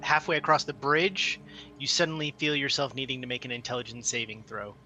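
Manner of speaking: ordinary talk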